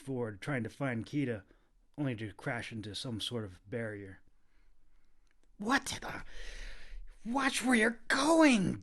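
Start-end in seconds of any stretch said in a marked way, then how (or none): 5.6-8.8 hissed. A narrator's reading. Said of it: "Kita hissed".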